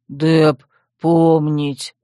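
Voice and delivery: slow, heavy brute voice; primitive brute. A narrator's reading. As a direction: answer in a simple brute's drawl. Dep answers the girl.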